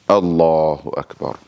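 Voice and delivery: slow, drawn-out takbir recitation; prayer said aloud. Shown as a demonstration. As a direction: intone it aloud, stretching the long vowel of Allahu. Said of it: "So I'm saying it aloud".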